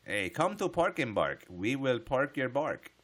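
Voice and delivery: loud, slow mock Japanese accent, hammed up; a hammed-up mock Japanese shop greeting. Streamer parodying a pork shop ad for chat, in a mock accent. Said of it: "that's how they pronounce pork".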